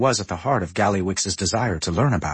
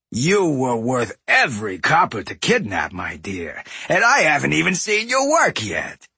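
gruff smug trade prince; raspy goblin boss; 2 semitones higher than usual. A narrator's reading. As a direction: leer and gloat, raspy. Voices Gallywix.

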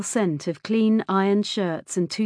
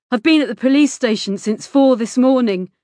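loud, higher, aggrieved protest; angry protest; 5 semitones higher, 7 dB louder than usual. A narrator's reading. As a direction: protest angrily, pushing the volume up. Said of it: "He looked angry".

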